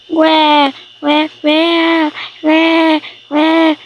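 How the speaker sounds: high, loud pretend baby wail; play-acted infant bawling; crying baby. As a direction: imitate a baby going "wah, wah". Baby wails at thunder.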